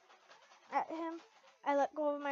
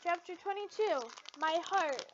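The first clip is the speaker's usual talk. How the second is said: usual speaking voice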